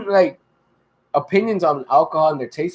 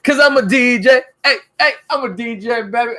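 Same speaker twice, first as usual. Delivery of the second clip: DJ voice; 6 semitones higher than usual. Acted. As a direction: pump it up like a radio DJ. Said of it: "Why do you sound like a D J?".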